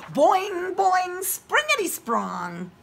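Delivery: high, slow springy boing; bouncy sound effect. Acted. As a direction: stretch each bounce out. Reads bouncy sound words.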